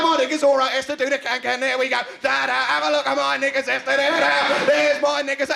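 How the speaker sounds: put-on high, loud cackling old cockney; mad cockney granny. Granny showing Esther her knickers, mad cockney cackle. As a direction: shriek it like a show-off granny. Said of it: "some mad old cockney granny cackling away".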